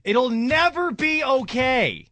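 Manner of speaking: slow, rising into a pained high cry; anguished protest. Retold or imitated. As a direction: insist through grief that it won't be fine. Grieving son's retort to partner.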